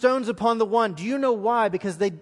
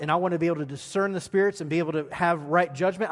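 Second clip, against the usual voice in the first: ordinary talk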